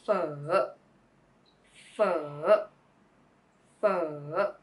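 f said as pho, low then rising; pho dipping down and back up. Shown as a demonstration. Tutor adds the falling-rising tone to pho. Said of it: "and adding the tone is".

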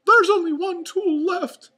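high, very loud falsetto yell; Mickey Mouse yell. A narrator's reading. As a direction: yell urgently as a cartoon mouse. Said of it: "yelled Mickey".

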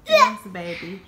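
excited praise. Real, not acted. Mother cheering on pets.

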